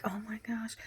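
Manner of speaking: a hushed, sinking oh my gosh; dismayed whisper. Retold or imitated. Reacting to wallet not found.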